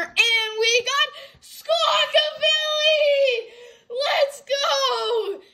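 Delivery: excited shout. Real, not acted. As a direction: yell it out with joy. Thrilled pull of favourite card.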